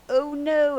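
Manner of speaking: high, loud oh no; mock dread. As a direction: play the alarm.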